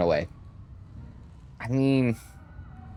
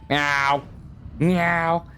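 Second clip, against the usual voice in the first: short mock meows, the second pitched up; playful cat meow; 5 semitones higher than usual. Player posing as a cat to fool the knight, meowing. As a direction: play at being a cat. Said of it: "but I'm meowing".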